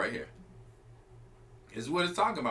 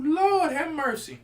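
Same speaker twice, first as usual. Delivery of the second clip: slow exclamation; 9 semitones higher, 3 dB louder, slower than usual. Real, not acted. Preacher reacting to scripture.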